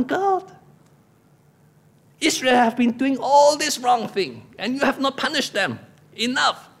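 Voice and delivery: high, exasperated pleading with God; grumpy complaint. Acted. Preacher voicing what Elijah feels in his heart, grumpy complaint. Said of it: "speak like this".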